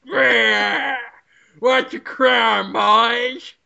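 a cocky drawled tough-guy line; tough detective. Pretend. Detective baby swaggering in with a cigar, slow. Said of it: "Why do I talk like that?".

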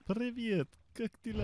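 mock Russian greeting. Sudden Russian greeting to player.

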